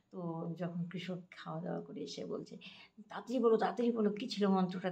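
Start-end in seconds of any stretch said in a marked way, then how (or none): 3.1-4.9 fast, higher, louder, eager pestering; eager hurry. Retold or imitated. Farmer hurrying his wife to tell the mantra, fast and eager. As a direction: rush her for the mantra, impatient.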